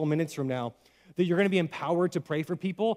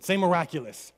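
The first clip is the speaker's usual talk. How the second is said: ordinary talk, nothing marked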